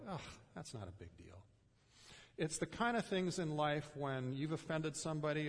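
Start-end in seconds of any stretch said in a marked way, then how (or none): 0.1-1.4 low, quiet, brushing it off; dismissive. Retold or imitated. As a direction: wave it away with a groan. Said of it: "they kinda go".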